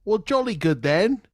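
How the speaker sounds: hammed-up posh British accent, high and loud. Host switching to a mock British accent, hammed-up British. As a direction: play a jolly Englishman. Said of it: "Not in that accent".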